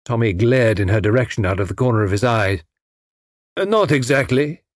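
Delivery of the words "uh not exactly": put-on hesitant, slightly foreign-accented reply; said with difficulty. A narrator's reading. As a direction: get the answer out with some difficulty. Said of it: "he said with difficulty".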